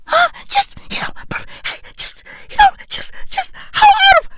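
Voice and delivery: mock crying squeal. Mocking tears over out-of-touch rich, mock crying squeal. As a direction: fake a hysterical breakdown.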